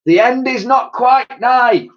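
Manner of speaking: high, loud doom-crier's call; shouted. A narrator's reading. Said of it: "he shouted".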